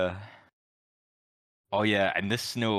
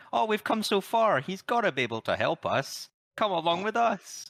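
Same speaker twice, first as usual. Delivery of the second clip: Dorothy; 11 semitones higher than usual. Retold or imitated. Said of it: "she's like".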